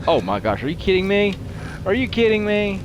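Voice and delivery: can't believe what the game just did; exasperated disbelief. Real, not acted. Game mishap, exasperated outburst.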